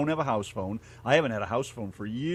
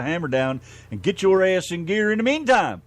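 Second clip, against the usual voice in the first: upbeat radio intro, lifted on "meantime"; DJ announcing the song; 5 semitones higher, 6 dB louder than usual. Real, not acted. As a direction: sell the next record like a DJ. Host announcing the next record.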